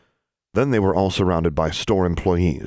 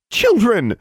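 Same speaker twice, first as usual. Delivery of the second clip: loud, high scolding shout; crying out; 6 dB louder than usual. A narrator's reading. Gwendolyn tries to halt the fight, loud high cry. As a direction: cry out sharply in alarm. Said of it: "Gwendolyn cried out".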